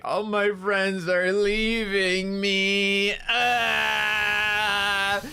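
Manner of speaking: slow, high drawn-out wail; mock crying. Friend cries as her collab partners graduate, mock wailing. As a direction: imitate a friend bawling, hammed-up. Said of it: "like, crying and shit".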